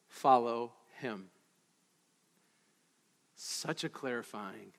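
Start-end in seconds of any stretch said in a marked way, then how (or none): 0.1-1.3 a quiet, lower, slow mocking echo; hushed and slow; soft low aside. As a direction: drop the voice low and soft on follow him. Dropped low, mock follow Baal.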